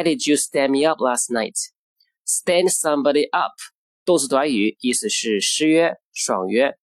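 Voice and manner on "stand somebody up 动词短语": normal talking voice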